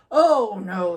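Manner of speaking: Papa Bear brushing the idea aside loudly; loud protest. Acted. Papa refuses allowance.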